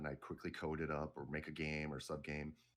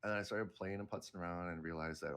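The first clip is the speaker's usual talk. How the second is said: usual speaking voice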